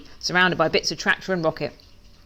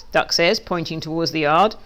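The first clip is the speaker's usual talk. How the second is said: usual speaking voice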